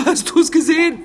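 high, panicky question; high-pitched scared gasp of a question; frightened. Acted. Frightened at creature.

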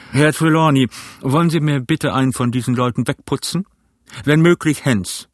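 stern captain giving an order; ship captain. A narrator's reading. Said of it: "sagte der Kapitän".

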